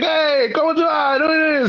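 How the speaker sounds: high, drawn-out Italian call across the street; imitating an old Italian neighbor calling out; old Italian man calling. Old man calling the teen from across the street, high Italian voice. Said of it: "speaking to me in Italian from across the street".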